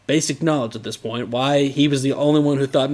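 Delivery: ordinary delivery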